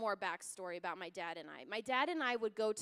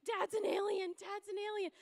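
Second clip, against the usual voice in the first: high-pitched mock wail of panic; mock screaming; 10 semitones higher than usual. Panicking brother to his sister about their dad, mock screaming. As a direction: imitate a hysterical little brother, pitched way up.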